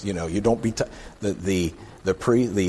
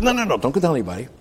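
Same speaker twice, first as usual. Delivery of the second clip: higher, rapid-fire objection; hasty shushing; 6 semitones higher, faster than usual. Acted. Jesus stops the healed man telling everyone, hasty objection. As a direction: cut him off quickly.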